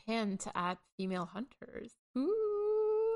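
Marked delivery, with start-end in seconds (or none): 2.2-3.2 high, delighted ooh; a thrilled little gasp of interest; excited ooh. Real, not acted. Reacting to female hunters hint.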